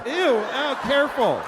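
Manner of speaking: high mock squeamish yelp as the wife; disgusted wife. Wife steering the mess away, mock disgusted.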